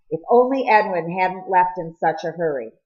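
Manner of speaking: usual speaking voice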